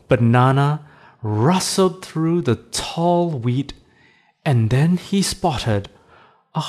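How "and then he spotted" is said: plain reading voice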